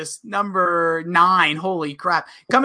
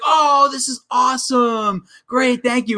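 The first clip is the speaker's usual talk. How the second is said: slow awestruck quote; 5 semitones higher, slower than usual. Retold or imitated. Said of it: "He's like".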